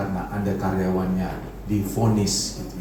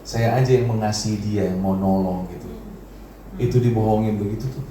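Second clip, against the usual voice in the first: normal talking voice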